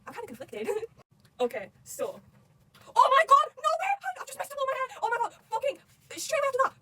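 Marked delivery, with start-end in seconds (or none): 3.0-6.7 very high, loud disbelief; shocked outburst. Real, not acted. Reader hitting a shocking plot twist, loud high outburst. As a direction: shriek it, stunned by the twist.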